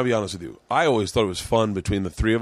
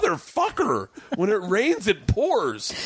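higher, fed-up mock curse; exasperated; 7 semitones higher than usual. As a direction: throw up your hands at the bad luck. Reacting to bad news piling up.

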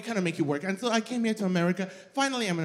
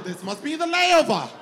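much higher, louder disbelieving cry; shocked laughing shout; 9 semitones higher, 7 dB louder than usual. Retold or imitated. Arriving in a Minnesota blizzard, a shocked laughing shout. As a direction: refuse the reality, shouting through a laugh. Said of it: "I said".

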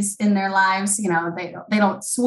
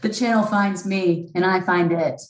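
Elizabeth Holmes impression. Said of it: "to channel Elizabeth?".

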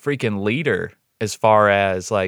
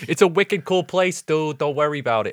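high-pitched, hammed-up Boston accent; mock Boston local, high and playful; 6 semitones higher than usual. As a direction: put on a joking Boston accent, pitched well up. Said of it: "use our Boston accents all the time".

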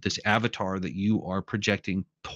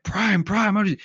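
higher-pitched mock cries to a comrade; urgent calling out; 10 semitones higher than usual. Comrades call to fallen Optimus Prime, urgent calling.

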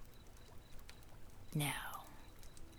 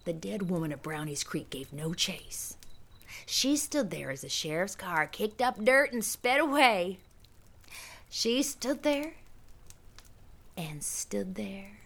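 spooky whisper. A narrator's reading. Spooky whispered campfire narration.

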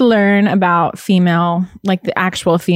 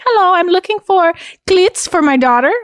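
put-on mom's thick South American accent, pitched up; 10 semitones higher than usual. Mom calling a sports store, thick South American accent. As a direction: imitate a mother's heavy South American accent on the phone. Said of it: "She has a very thick South American accent".

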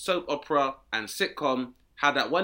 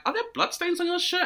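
alarmed. Retold or imitated. Alarmed passenger question.

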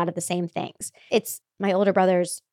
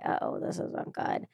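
whispered inner thought, much softer; whispered dread; 12 dB quieter, slower than usual. Retold or imitated. Recalling her sister coming over, a hushed whisper of dread. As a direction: breathe it out as a private worry. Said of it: "And I was like".

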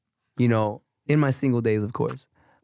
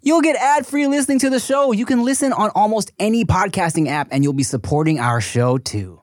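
loud, high, upbeat ad voice; ad read; 8 semitones higher, 5 dB louder than usual. Acted. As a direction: pitch it like a host.

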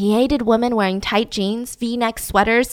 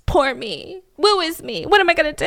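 mock self-pity; 5 semitones higher than usual.

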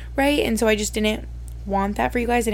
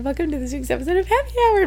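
quick replay of a high-pitched intro; high-voice demo; 7 semitones higher, faster than usual. Shown as a demonstration. Host replaying their own welcome to listeners, high and quick. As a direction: mimic your own intro, pitch soaring at the end. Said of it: "My voice got really high".

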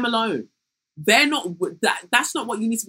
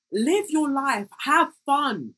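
ordinary talk

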